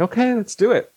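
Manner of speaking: high, keen "okay"; eager agreement. Retold or imitated. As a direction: jump in eagerly. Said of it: "And I'm like".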